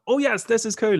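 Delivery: high, acted-out delight; mirrored glee. Fan voicing the audience watching Superman fly, high gleeful delight. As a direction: cheer it like a thrilled cinemagoer. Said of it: "the audience mirrors that kind of reaction".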